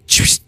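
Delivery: sharp breathy "choosh" into the mic; a quick punchy "choosh" to test yourself; warm-up sound. Shown as a demonstration. Coach showing his pre-speech warm-up noise, a sharp choosh. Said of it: "I always go".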